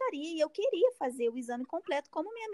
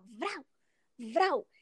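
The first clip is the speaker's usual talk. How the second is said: short 'vrau' swipe noises; imitated rough wiping. Patient showing how roughly she was wiped, imitated swipe sound. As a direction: voice two rough swipes, each a single burst. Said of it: "Ela limpava assim, ó".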